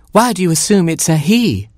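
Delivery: high, challenging hen voice; high-pitched offended hen; Mama Hen. A narrator's reading. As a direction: question it sharply as a mother hen. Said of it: "said Mama Hen".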